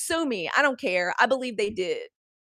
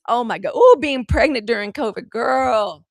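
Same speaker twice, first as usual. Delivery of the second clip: loud, exclaiming oh my God; loud exclamation; 2 semitones higher, 12 dB louder than usual. Real, not acted. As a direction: cry out in disbelief. Exclaiming about pregnancy during covid.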